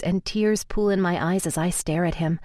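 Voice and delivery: ordinary delivery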